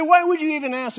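high, loud, demanding why; indignant yell. Retold or imitated. The golfer shouting at the preacher, high and loud. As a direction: throw the question back hard.

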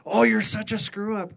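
high, loud sneering taunt, voicing the accuser; mocking devil voice. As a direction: jeer it like the devil mocking you. Devil taunting him in worship.

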